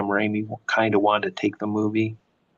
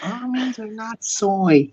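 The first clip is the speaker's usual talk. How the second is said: a touch of high, slow entitled whine; lightly mocked Karen complaint; 11 semitones higher, slower than usual. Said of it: "The Karens are annoying".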